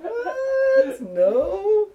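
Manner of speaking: high laughing What, No; surprised denial. Retold or imitated. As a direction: play it shocked and amused. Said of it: "I'm like".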